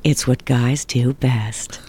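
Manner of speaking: slow low commercial announcer; beer ad voiceover. Shown as a demonstration. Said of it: "you hear my voice just go".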